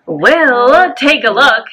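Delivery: drawn out, teasing reveal; big announcement. Real, not acted. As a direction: build up the big news. Playful big announcement lead-in.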